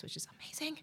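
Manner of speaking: breathy awed whisper. Real, not acted. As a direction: whisper it in delight. Gushing about the bakery.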